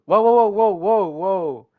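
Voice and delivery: loud repeated warning cries; urgent loud whoas; alarmed whoa. Real, not acted. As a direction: cut in to stop her, loudly. Alarmed by Sayori's words.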